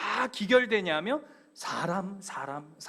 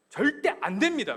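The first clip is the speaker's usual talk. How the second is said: firm protest; 5 semitones higher, 5 dB louder than usual. Acted. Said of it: "꾸짖으면서".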